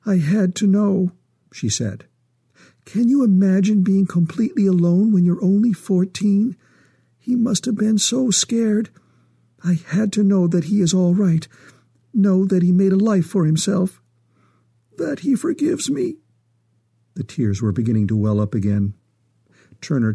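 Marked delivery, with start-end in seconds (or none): 0.1-1.1 higher-pitched, pleading woman's voice; distressed mother. A narrator's reading. Tearful mother explains herself. 2.9-16.2 high woman's voice, growing more tearful; anguished mother close to tears; distressed mother, pleading. A narrator's reading. Tearful mother pleads for her son.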